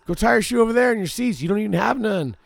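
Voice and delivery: high, loud and fast yell; angry yell. Retold or imitated. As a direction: tell her off quickly. Yelling at woman in seat.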